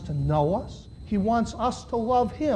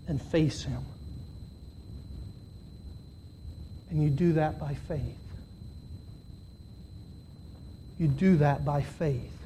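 soft altar call; 4 semitones lower, 9 dB quieter than usual. Real, not acted. Preacher inviting listeners to faith, softly. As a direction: draw them in gently.

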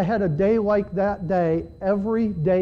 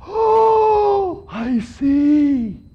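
high, loud mock 'aha' voice; mock sudden insight; 9 semitones higher, 8 dB louder, much slower than usual. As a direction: play a dim disciple finally getting it. Mocking disciples' sudden insight.

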